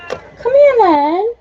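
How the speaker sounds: high, loud sing-song call; calling the cat. Real, not acted. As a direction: coax the cat over loudly.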